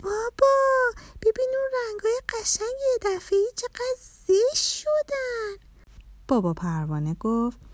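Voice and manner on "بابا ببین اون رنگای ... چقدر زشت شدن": put-on very high, dismayed little-girl cry; child calling out. A narrator's reading. Little girl exclaiming to father at the spoiled colours, high-pitched dismay. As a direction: call to dad in a high child's voice. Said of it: "با صدای بلند گفت".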